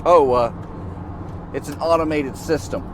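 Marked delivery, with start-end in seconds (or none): none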